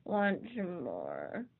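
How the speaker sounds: slow drowsy mumble from the bag; sleepy slow offer; muffled sleepy voice. Acted. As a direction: mumble it half asleep. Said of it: "from the depths of his sleeping bag".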